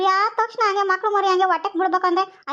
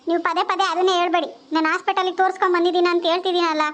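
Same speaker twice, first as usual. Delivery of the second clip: usual speaking voice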